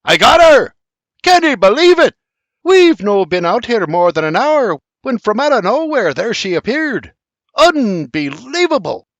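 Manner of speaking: loud, high victory yell; triumphant shout. A narrator's reading. Sir John shouting his triumph over the elk, loud. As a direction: crow over the kill at full voice. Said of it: "Sir John shouts".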